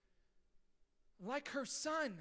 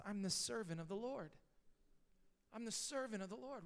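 soft and surrendered, almost hushed; quiet, humble reply; 4 semitones lower, 8 dB quieter than usual. Retold or imitated. Pastor voicing young Mary's quiet yes to the angel, gently. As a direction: say it as a humble servant, softly. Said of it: "She responds with this most amazing response".

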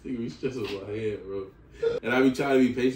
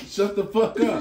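retold yell; 9 semitones higher, 6 dB louder than usual. Repeats the same yell louder.

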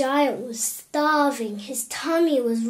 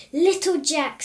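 loud, mildly irritated snap; raised, sharp storybook challenge; snapping giant; 5 semitones higher, 7 dB louder than usual. A narrator's reading.

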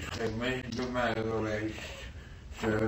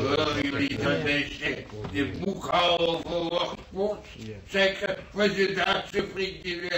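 loud, higher taunting call across the ward; patient loudly taunting the bookkeeper across the ward; mocking shout; 6 semitones higher, 4 dB louder than usual. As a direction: jeer at him loudly. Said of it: "schreeuw niet zo hard".

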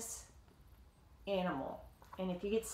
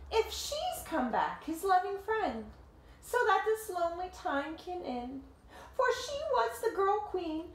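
lively read-aloud voice, higher than the chat; story narration; 6 semitones higher than usual. A narrator's reading. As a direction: tell it like a picture book to kids. Reading the picture book aloud.

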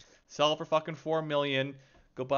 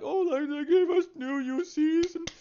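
mocking fanboy voice; 13 semitones higher than usual. Streamer mocking players thankful for free items, high sarcastic falsetto. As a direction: parody a gullible player happily praising the devs. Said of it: "I just can't stand when people are like".

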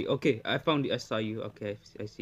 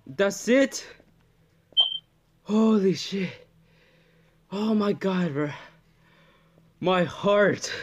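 shaken, louder and higher; scared horror game streamer; 5 semitones higher, 5 dB louder, slower than usual. Real, not acted. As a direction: heart still pounding from the scare. After jump scare.

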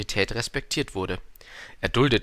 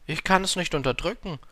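higher-pitched, flustered little prince; verwirrter Prinz; 8 semitones higher than usual. A narrator's reading. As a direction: answer confused, lifting the pitch. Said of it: "antwortete der kleine Prinz ganz verwirrt". (verwirrt, mit höherer Stimme)

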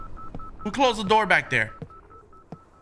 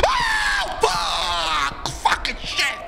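scream of terror; 14 semitones higher, much slower than usual. Real, not acted. Player screams as the ghost jumps at him, terrified. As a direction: scream as the ghost grabs you.

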